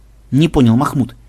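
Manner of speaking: plain reading voice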